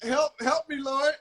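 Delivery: high-pitched plea for patience; mock prayer. Husband asking God for help with a drunk, pleading. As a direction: beg the Lord for help, drawing out the end.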